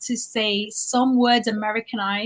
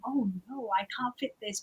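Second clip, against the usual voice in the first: much softer self-doubt; quiet inner worry; 10 dB quieter than usual. Retold or imitated. Worrying in a changing room, softly. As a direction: mutter the worry to yourself. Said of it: "I start to think".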